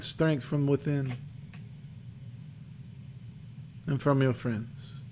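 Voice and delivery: a hint of old seer; lightly voiced crone, pausing between phrases; crackly old crone. Fortune teller counsels a visitor, lightly voiced crone. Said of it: "her voice crackles".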